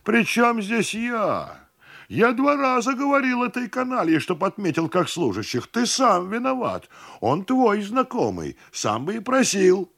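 high-pitched, heated self-defence; indignant. A narrator's reading. Cousin blames host for the listing, indignant. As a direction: argue back high, voice straining in protest.

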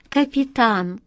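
ordinary delivery, nothing marked

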